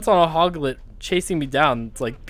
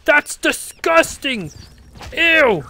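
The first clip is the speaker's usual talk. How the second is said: disgusted yelling; 11 semitones higher, 6 dB louder, much slower than usual. Real, not acted. Grossed out by alien attack.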